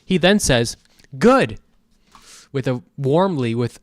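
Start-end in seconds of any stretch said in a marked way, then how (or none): 1.2-1.6 high, loud, forced cheer; mock-cheery, punched-up reply; massive fake smile. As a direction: overdo the warmth insincerely. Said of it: "with the same massive fake smile".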